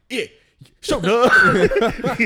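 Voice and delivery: high, slow, loud call; drawn-out shout. Retold or imitated. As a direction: stretch it out like a fan yelling at a show. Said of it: "be like".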